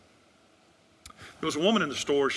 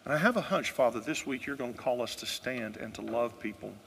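closing prayer; 6 dB quieter than usual. Acted. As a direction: pray gently over the people.

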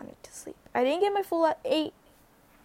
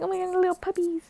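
happy gush; 6 semitones higher, faster than usual. Retold or imitated. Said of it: "I was so happy".

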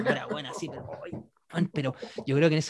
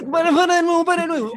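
urgent shout; 11 semitones higher, 6 dB louder than usual. Retold or imitated. Drunk urgently asks to stop again.

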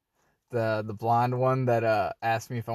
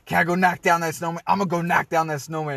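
fast, high, loud mock demand; imitated rage; 6 semitones higher, 4 dB louder, faster than usual. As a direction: rush it out, imitating an enraged coworker. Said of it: "came in all enraged".

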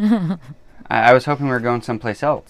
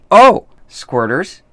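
excited; 7 semitones higher, 8 dB louder, much slower than usual. Real, not acted. Reading the topic aloud.

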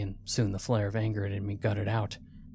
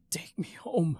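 higher-pitched croaking plea; croaked plea; 8 semitones higher than usual. A narrator's reading. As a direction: beg hoarsely to go home. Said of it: "I croaked out".

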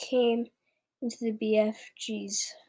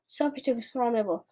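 plain reading voice

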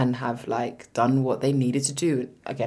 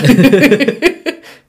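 loud laugh; 12 semitones higher, 12 dB louder than usual. Real, not acted. Cackling at the joke.

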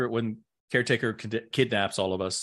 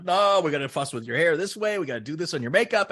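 higher, fast voice of a pushy stylist; hammed-up pushy makeup staff; a mock stylist brushing off her wishes; bossy stylist; 7 semitones higher than usual. Hair and makeup staff quoted.

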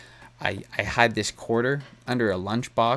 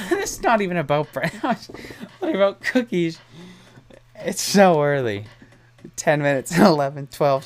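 higher, giggling through the words; laughing at herself; 6 semitones higher, 5 dB louder than usual. Real, not acted. Host laughs at drifting from bright lines to cookies, giggly and higher. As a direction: laugh as you catch yourself rambling. Laughing at her own tangent.

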